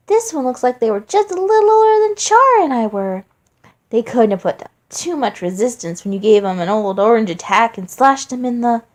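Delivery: mock sweet taunt. Flowey taunts his weeping father with fake sweetness. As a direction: needle him in a fake-innocent sing-song voice.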